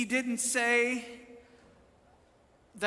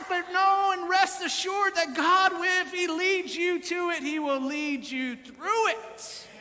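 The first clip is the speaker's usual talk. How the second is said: high, driving sermon climax; exhorting preacher in full cry; full-force preaching; 7 semitones higher, 5 dB louder than usual. Real, not acted. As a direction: build to a ringing promise, pitched high.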